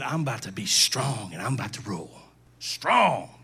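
low, strongman voice for Satan; put-on low, menacing Satan; boastful Satan. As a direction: drop deep and boast like a villain. Voicing Satan boasting of his rule.

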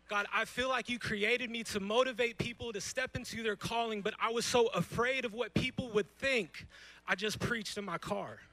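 half-pretend confession building from earnest to loud; pleading prayer. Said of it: "that would be like me saying to God".

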